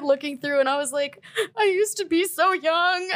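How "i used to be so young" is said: high, slow teary whimper; half-laughing fake sob; mock crying. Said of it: "I started crying".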